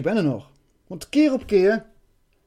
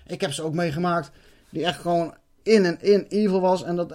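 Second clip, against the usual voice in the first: usual speaking voice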